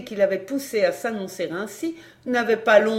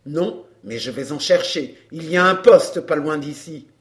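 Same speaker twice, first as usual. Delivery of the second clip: low voice, swelling louder; threatening man; 5 semitones lower, 5 dB louder than usual. A narrator's reading. Man threatening to fetch police, grim. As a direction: warn her, low and forceful.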